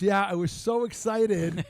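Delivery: excited retelling. Retold or imitated. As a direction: play up his excitement, stretched out loud. Acting out the sandwich guy's excitement.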